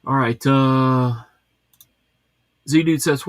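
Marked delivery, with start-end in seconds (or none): none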